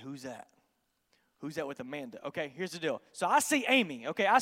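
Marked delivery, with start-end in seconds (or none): 0.0-2.3 low, hushed, discreet question; hushed awe. Retold or imitated. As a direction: ask your friend under your breath. Smitten, discreetly asking his friend.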